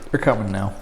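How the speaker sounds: hiccuping from spicy wings; hiccups. Real, not acted. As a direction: talk through the hiccups. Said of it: "there's the hiccups".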